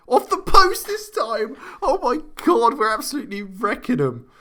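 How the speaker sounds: very high, loud, exhilarated yell; ecstatic goal shout. Real, not acted. Commentator as another goes in off the post, ecstatic. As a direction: shout the goal out, then ease down slightly.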